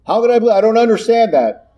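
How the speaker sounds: a high, puzzled voice for the doubter; doubting believer. Retold or imitated. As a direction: play the doubter who can't grasp it.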